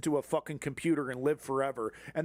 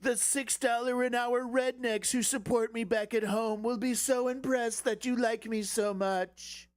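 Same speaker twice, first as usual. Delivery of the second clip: simper like a starstruck Trump; Trump impression; 6 semitones higher than usual. Impersonating Trump to Xi.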